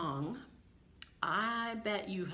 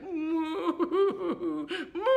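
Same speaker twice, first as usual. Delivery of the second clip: higher, wobbling sobbed moos; weeping cow; 7 semitones higher than usual. Acted. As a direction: moo as if crying. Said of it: "what a cow weeping sounds like".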